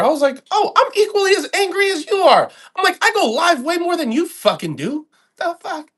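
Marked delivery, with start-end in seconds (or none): 0.5-2.5 high, loud mock outrage; mock furious. Quoting his reply to an angry poster, high and loud mock fury. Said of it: "So pissed". 3.0-5.9 high, loud indignant retort; mock pissed rant. Boasting he streams more, in a high loud mock rant. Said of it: "So pissed".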